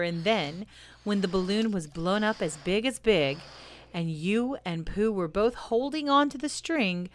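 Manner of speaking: ordinary delivery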